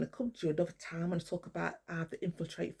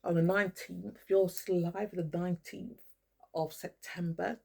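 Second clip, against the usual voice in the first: ordinary talk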